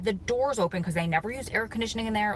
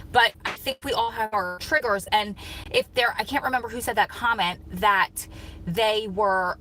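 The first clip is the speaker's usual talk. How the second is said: ordinary delivery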